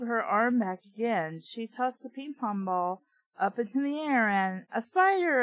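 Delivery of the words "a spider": high fake alarm; mock scream. A narrator's reading. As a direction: shriek a fake warning to startle her. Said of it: "I screamed".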